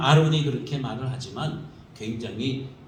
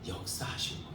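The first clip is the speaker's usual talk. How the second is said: whispered to oneself; whispered trust; 13 dB quieter than usual. Pretend. Believer cornered yet trusting God, whispered to oneself. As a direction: breathe the words out in a hush, 역사하실 거야 unvoiced and soft.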